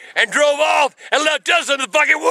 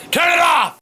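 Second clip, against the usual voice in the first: angry shout; 6 semitones higher than usual. Real, not acted. Grandpa yelling at the camera.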